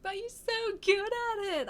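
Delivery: high, pleading mum voice; imitating mum. Mum urging her to keep playing clarinet, high mock pleading. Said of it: "my mum was like".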